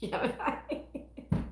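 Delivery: unvoiced wheezing laugh; silent breathy laugh. Real, not acted. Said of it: "swallowed a bug".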